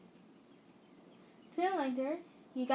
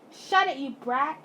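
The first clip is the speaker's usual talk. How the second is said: slow, louder barked order; angry yell; 7 dB louder than usual. Acted. Dad yelling at his child to shut up. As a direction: bark it at the kid. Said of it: "your dad yelled".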